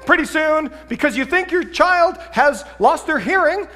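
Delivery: usual speaking voice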